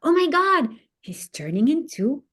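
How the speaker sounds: put-on high, loud amazed little boy; excited child. Boy watching his caterpillar start its cocoon, high and amazed. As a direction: gasp it out like a kid seeing magic. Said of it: "says Yossi".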